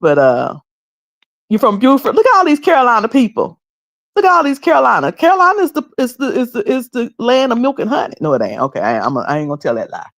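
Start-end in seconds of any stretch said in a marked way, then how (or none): 1.5-8.1 excited hype. Real, not acted. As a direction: cheer on the Carolina crowd. Hyping Carolina viewers in chat.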